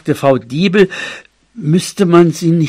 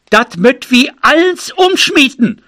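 high, raised dialect cry; calling out; 9 semitones higher, 4 dB louder than usual. A narrator's reading. Neighbour Frau Kirschbeet exclaiming to the group, calling out. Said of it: "Frau Kirschbeet rief".

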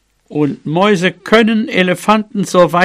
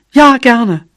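higher, louder eager yes; delighted; 7 semitones higher, 6 dB louder than usual. Acted. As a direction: accept with quick delight. Mouse delightedly accepts a kiss. (freudig, mit gehobener Stimme)

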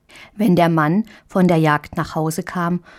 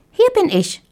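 much higher squeaky mouse voice; a tiny mouse answering cheerfully. A narrator's reading. Mouse answers tiny Marie, much higher squeak.

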